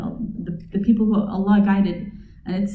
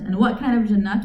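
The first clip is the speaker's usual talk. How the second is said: usual speaking voice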